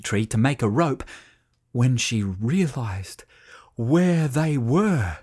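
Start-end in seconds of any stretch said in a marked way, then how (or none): 1.7-5.2 whispered narration. A narrator's reading.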